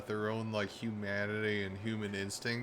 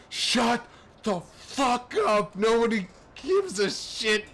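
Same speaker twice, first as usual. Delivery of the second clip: loud, slow furious shout; pretend yell; 9 semitones higher, 7 dB louder, slower than usual. Ranting streamer yelling at a smug conservative. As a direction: yell it like you've finally snapped. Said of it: "I just wanna yell".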